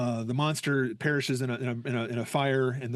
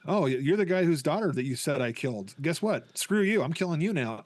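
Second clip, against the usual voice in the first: ordinary talk, nothing marked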